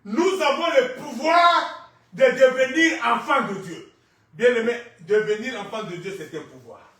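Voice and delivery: forceful preaching. Real, not acted. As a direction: proclaim it loudly, stretching key words.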